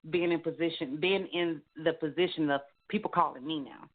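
normal talking voice

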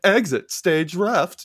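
high, slow theatrical cartoon lion; Snagglepuss voice. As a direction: ham it up like Snagglepuss. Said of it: "things I never do impressions of".

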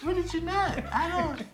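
high-pitched imitation of a confused girlfriend. Man mimicking his girlfriend, high and puzzled. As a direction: play her confused and clueless, pitch way up.

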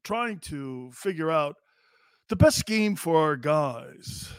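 slow, halting press-conference answer; Jonathan Gannon. Acted. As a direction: hem and draw it out like a stumped coach. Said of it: "Jonathan Gannon, uh, taking questions now".

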